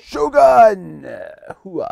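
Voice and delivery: loud cheer, high and far; loud gung-ho soldier. Acted. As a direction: whoop it out like a soldier. Magic Eight Ball answer, cheering.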